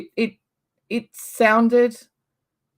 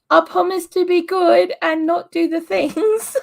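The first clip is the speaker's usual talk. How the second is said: mock-obedient promise; 10 semitones higher, 6 dB louder than usual. Playfully promising to behave.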